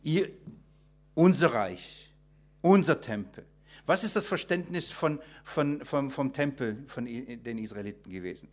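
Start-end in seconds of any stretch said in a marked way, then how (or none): none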